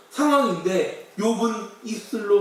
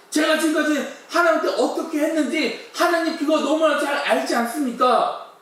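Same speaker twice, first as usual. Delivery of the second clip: resentful complaint; 6 semitones higher than usual. Retold or imitated. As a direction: cry out to God, hurt and accusing. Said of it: "나도 모르게 하나님을 원망합니다". (하나님께 원망하는 높고 큰 항의)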